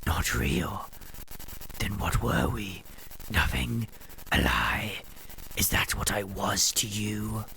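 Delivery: bitter accusing whisper from a ghost; ghostly whisper. Acted. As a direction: whisper the betrayal coldly.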